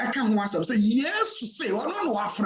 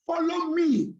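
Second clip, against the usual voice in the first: "Follow me" pitched high, "me" held; stress on ME; 8 semitones higher than usual. Shown as a demonstration. Preacher showing whom to follow, stress on "me". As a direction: lift the phrase and lean on "me". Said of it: "the emphasis in me".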